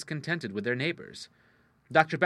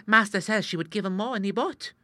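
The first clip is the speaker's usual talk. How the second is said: put-on confidential page boy; 9 semitones higher, 4 dB louder than usual. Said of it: "in a confidential whisper".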